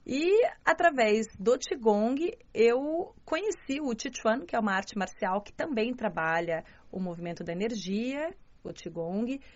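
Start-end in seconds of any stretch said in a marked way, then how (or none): none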